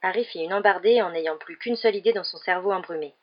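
ordinary delivery, nothing marked

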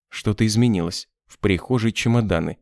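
ordinary delivery, nothing marked

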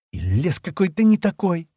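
high-pitched peevish musing; irritated thought. A narrator's reading. Шира to herself about the strange forest, irritated. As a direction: think it with annoyance. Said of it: "раздражённо подумала Шира".

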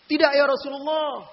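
high, loud emphatic no; loud firm refusal. Retold or imitated. Young man answering the Prophet, retold as a loud high no. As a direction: cry out the refusal.

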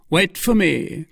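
calling out. A narrator's reading. Musketeer to his friends as he leaves, calling out mildly. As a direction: call it out to companions, without shouting. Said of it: "cried he".

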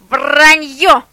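loud furious bark; guard dog barking in rage. A narrator's reading. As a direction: snap it out like an angry dog. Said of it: "рявкнул шеф".

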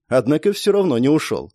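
plain reading voice

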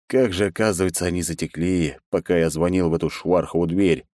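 ordinary delivery

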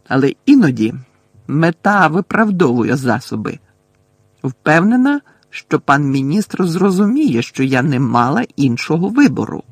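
loud, deliberate figure voice, lifting at phrase ends; loud self-righteous professor; tense, self-justifying Umbridge. A narrator's reading. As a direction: justify yourself forcefully as the stern professor.

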